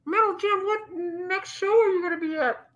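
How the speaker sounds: high-pitched muffled question. Pretend. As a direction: ask it high, as if through a covered mouth. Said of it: "You muffled".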